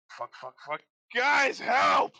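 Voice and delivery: muttered curses, then a loud high shout; panicked guard. Acted. Cornered guard yelling to his friends for help. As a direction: curse under your breath, then yell for help. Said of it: "he's like".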